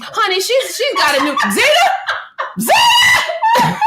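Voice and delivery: high shrieking laugh; laughing squeal. Real, not acted. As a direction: squeal the name through laughter. Reacting to new business for Zeta.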